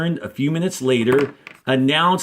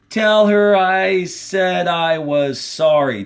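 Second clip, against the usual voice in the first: 1 pleading; 6 semitones higher, 3 dB louder, slower than usual. Acted. Squid pleading, emotional request.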